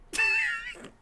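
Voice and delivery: quick shrieking laugh; short high laugh. Real, not acted. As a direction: let out a brief laugh. Laughing at the sound.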